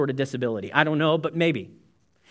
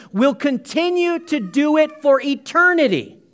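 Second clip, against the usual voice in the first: loud, raised preaching voice; louder, higher sermon build to the congregation; full-force preaching; 4 semitones higher, 5 dB louder than usual. Acted. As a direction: drive it home to the whole congregation.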